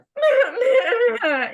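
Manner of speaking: loud, high mock bawling; hammed-up wordless whine; mock whining wail. Sulker at the edge of the sandbox, mock wailing.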